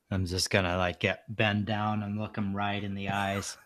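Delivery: ordinary talk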